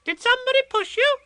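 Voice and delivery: very high, fast little Roo voice; eager child, quick and breathless; excited. A narrator's reading. Roo eagerly asking Eeyore, very high and fast. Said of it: "said Roo excitedly".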